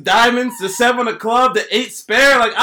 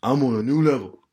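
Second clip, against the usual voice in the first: quoting Future lyric; 7 semitones lower, 4 dB quieter than usual. Retold or imitated. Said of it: "I'm like Future".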